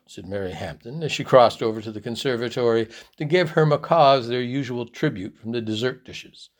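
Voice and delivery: ordinary delivery, nothing marked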